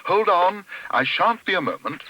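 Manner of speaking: high, loud reassuring call; crying out. A narrator's reading. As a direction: call out high to someone locked in. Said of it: "cried Paddington".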